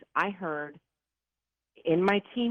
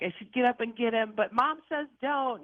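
retold inner plea, pitched up high; higher, pleading retelling of a torn thought; anguished inner debate; 8 semitones higher than usual. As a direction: quote your torn thoughts, voice lifted high. Torn mother quoting her own thoughts.

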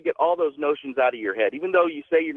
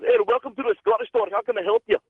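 lightly voiced cheery shopkeeper, a touch higher; friendly Scottish shopkeeper; 7 semitones higher than usual. Caller imitating a friendly shopkeeper greeting him warmly. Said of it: "the voice just did not fit the man".

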